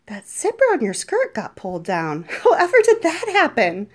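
amused, laughing through words. Acted. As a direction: laugh as you tease. Feigned innocence, laughing.

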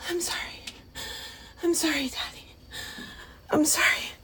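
hushed apology to Daddy; whispered sorry. Acted. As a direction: whisper it meekly.

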